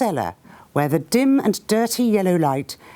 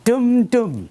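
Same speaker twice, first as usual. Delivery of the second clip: gun reply; 4 semitones higher, 3 dB louder than usual. Retold or imitated. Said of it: "somebody in the German lines went".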